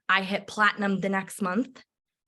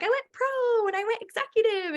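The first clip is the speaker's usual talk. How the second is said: excited; 13 semitones higher than usual. Retold or imitated. Teammate announcing rank up.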